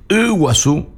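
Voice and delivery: Iguazú said the Guaraní way, wa-SU with the final u sounded; teacher says Iguazú for radio listeners, sounding out the u; Guaraní pronunciation. Shown as a demonstration. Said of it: "Entonces voy a decir".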